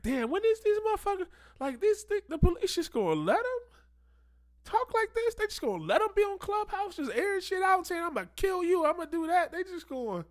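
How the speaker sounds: high-pitched incredulous squeal of a voice; mock disbelief. Commentator voices someone baffled at the police, high-pitched mock disbelief.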